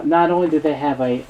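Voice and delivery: ordinary talk, nothing marked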